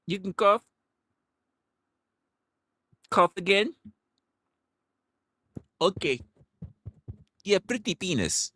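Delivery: high, bright-voiced nurse imitation; imitating the nurse. Retelling the nurse checking him, high mock nurse voice. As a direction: pitch it way up like the nurse. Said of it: "she was like".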